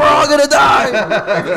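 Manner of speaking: high, drawn-out panicked yell; hammed-up shriek of doom, laughing; mock scream. Kid spotting a ship in the sky, mock panicked scream. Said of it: "just started screaming".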